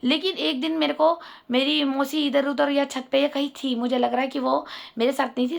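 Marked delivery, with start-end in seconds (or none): none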